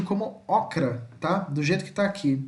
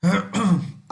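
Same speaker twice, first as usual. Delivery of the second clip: loud throat clearing; 3 semitones higher, 4 dB louder than usual. Real, not acted. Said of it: "minha voz falhou".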